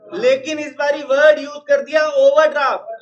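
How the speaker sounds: emphatic teacher call. Real, not acted. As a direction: raise your voice on overdraft. Teacher stresses tricky word. (ज़ोर देकर ऊँची आवाज़ में)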